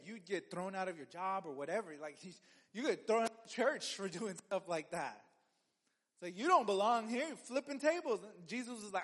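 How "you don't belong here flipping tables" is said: mock scolding. Church scolding table flipper.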